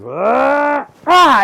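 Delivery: high, loud growling heave; dragon throwing. Acted. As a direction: roar with the effort of a throw. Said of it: "And he goes".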